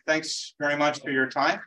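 ordinary talk, nothing marked

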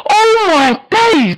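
stunned outcry. Real, not acted. Reacts to opponent's great skill.

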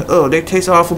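ordinary delivery

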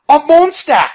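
very high, loud cry of alarm; panicked yell. Acted. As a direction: scream a warning in panic. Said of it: "she yelled".

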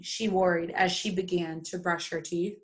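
ordinary delivery, nothing marked

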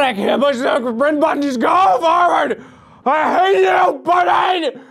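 high shout, building in pitch; frustrated yelling. Real, not acted. Player hating the sprint button, frustrated yelling.

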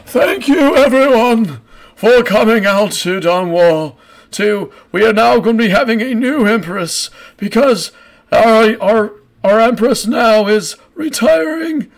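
put-on slow, stretched-out speech in an old man's high voice. Old man addresses the gathered crowd at the ceremony, old man voice. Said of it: "you hear this old man going".

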